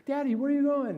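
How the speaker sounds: put-on high, eager child asking daddy; little girl voice. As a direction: imitate a small daughter calling out. Daughter voiced again.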